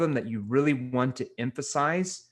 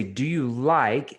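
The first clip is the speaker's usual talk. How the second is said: "Do you like?" slow and weighted; stress on like; 4 dB louder, much slower than usual. Shown as a demonstration. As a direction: hold the word like. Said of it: "so I say".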